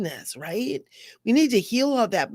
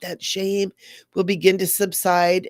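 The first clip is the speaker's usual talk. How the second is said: ordinary talk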